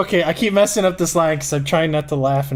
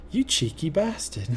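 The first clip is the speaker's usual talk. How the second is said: quieter, cheeky British voice; British accent; 2 semitones higher, 6 dB quieter than usual. Acted. The British voice in their head, playful British accent. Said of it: "I have a British accent in my head".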